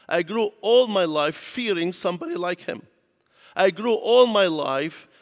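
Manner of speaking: preacher's rising repetition, stretching the opening phrase; building emphatic repetition. Real, not acted. Emphatic repeated confession to congregation.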